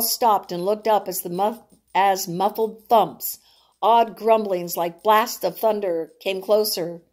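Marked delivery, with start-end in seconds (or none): none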